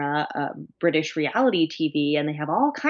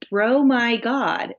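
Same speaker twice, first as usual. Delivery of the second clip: dramatic headline read; 6 semitones higher, 5 dB louder, much slower than usual. Retold or imitated. Reading the show's headline dramatically.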